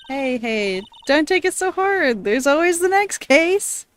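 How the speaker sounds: lightly voiced cheerful young woman; Maya voice. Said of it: "Don't make Maya sound sad".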